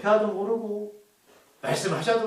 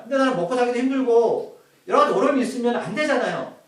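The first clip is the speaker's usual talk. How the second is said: ordinary talk, nothing marked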